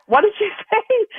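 very high, laughing disbelief; giggling as she asks what was said; excited confusion. Retold or imitated. Asking Jack and Gary what Nancy said, a very high laughing disbelief. Confused question during the call.

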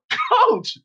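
high-pitched call across the field; player's yell. Retold or imitated. Player yells to coach about stuck teammate, high holler. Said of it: "One of the players yelled".

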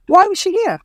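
lightly high curious colleague; colleague voiced. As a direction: ask it like a nosy coworker. Colleague asks in cake story.

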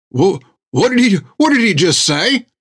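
high, stammering alarm; panicked disbelief. Acted. Patient hears he'll die.

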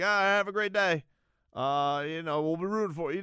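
lightly hammed-up small-talk cheer; overly chummy. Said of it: "a little bit overly chummy".

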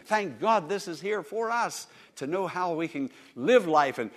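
usual speaking voice

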